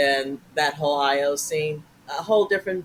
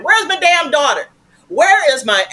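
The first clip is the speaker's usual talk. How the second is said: high, loud furious mom yelling; mom's screaming voice; 9 semitones higher, 9 dB louder than usual. Retold or imitated. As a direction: scream it like an angry little mother. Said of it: "doing her voice is we sound the same".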